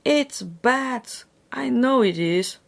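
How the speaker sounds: high, bright gleeful; happily. A narrator's reading. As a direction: relish the bad news. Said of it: "said her brother happily".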